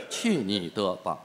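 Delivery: imitating partner. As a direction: drag out the catchphrase lazily. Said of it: "四个字儿".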